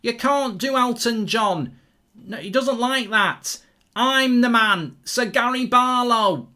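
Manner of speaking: hammed-up pop star boasting. Streamer doing a pop star bragging about himself, hammed up. Mock [name] bragging impression.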